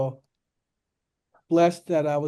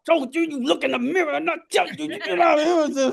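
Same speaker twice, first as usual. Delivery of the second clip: fired-up impression; 10 semitones higher than usual. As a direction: shout it like a revved-up preacher. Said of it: "can't you hear his voice?".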